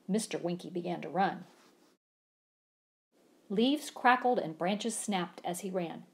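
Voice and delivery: usual speaking voice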